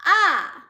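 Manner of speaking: "ah" falling sharply from high; falling fourth tone. Shown as a demonstration. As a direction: drop a loud "ah" top to bottom. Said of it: "falling tone".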